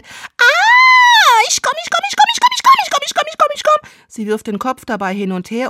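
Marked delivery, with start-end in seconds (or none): 0.0-3.9 extremely high, loud shrieking chant; piercing fake climax; mock orgasm scream. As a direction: scream like a hammy dub actress. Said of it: "bis sie schließlich schreit".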